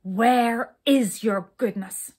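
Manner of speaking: loud, slow, hammered demand. A narrator's reading. King demanding an answer from brownies, furious. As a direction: demand it with weighty fury. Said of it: "The king grew angrier than ever".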